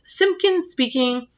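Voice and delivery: slow, self-important telephone greeting. Retold or imitated. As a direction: answer the phone like a pompous official, slowly. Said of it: "in his very important telephone voice".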